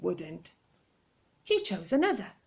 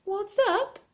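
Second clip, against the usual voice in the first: calling down; 12 semitones higher than usual. Acted. Calling down over railing.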